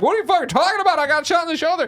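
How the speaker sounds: indignant outrage. Retold or imitated. Miles protests being shot, high and indignant. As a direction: protest in disbelief. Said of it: "he's like".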